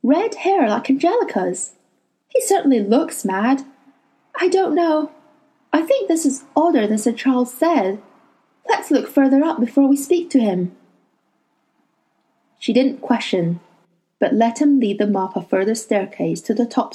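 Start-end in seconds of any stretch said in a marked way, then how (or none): 0.0-10.8 put-on higher voice for the girl; lightly voiced young girl. A narrator's reading. Lyra character voice.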